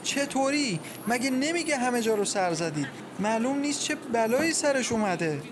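high, agitated questioning; distraught. A narrator's reading. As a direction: reject comfort in rising dread. Mother rejects comfort, fearing the worst.

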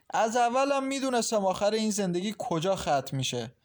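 high-pitched parting jibe; pointedly audible. A narrator's reading. Mother-in-law leaving, wanting the mother to hear, pointedly audible. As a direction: project it so she overhears. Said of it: "با صدایی که عاطفه بشنود گفت".

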